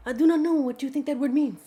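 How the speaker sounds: lightly put-on film accent quoting the line; bad Princess Bride accent. Quoting The Princess Bride hero in a bad mock accent. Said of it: "That was probably the worst accent".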